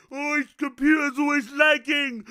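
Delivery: taunting put-on whine; mocking complainer. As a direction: imitate viewers moaning about lag.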